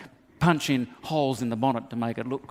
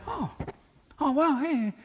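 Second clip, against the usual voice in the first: mock delight; 10 semitones higher than usual. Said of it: "Or he can say".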